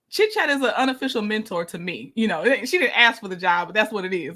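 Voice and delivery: ordinary talk, nothing marked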